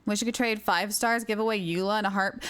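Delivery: usual speaking voice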